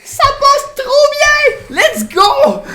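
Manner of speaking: very high, loud, slow squeal into laughter; thrilled squeal. Real, not acted. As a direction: squeal with delight at a perfect result. L'effet sonore marche parfaitement. (cri de joie très aigu, fort, en riant)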